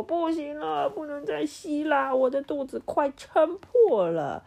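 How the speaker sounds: high strained cry, slowing at the end; strained shout. Acted. Overstuffed Toto crying that his belly will burst, high strained shout. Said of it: "他大叫着说".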